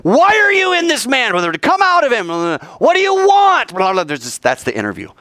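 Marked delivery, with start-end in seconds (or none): 0.0-3.8 hammed-up shouting exorcist; exorcist's interrogation. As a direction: demand answers from a demon. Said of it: "They are interviewing the demon".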